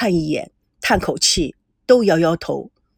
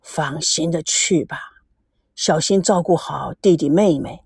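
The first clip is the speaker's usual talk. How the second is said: 低沉温和地嘱咐; low and gentle; 4 semitones lower than usual. Acted. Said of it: "声音低沉地说".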